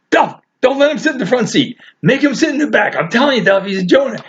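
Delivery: urgent, high-pitched mock warning; dead serious. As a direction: imitate friends insisting in deadly earnest. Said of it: "These guys are dead serious".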